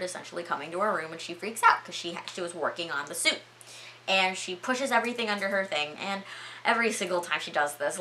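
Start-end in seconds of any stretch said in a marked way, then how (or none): none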